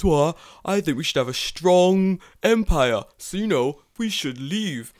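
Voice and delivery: mock a British empire nostalgist; mocking UK accent. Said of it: "your mocking, like, U K accent".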